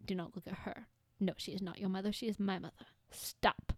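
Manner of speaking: hushed, standoffish dog warning people off; quietly possessive dog, sinking toward a whisper; antisocial dog impression. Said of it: "my impression of him is".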